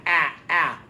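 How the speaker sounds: "ah" as an open short vowel, repeated; short vowel "ah" for the class; short a demo. Shown as a demonstration. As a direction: hold a clear open "ah, ah". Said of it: "So A says ah".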